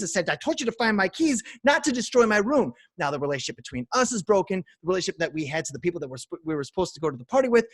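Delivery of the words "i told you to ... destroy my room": higher and louder, scolding the friend; angry comeback. Retold or imitated. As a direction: snap back at your friend. Acted quarrel about the room.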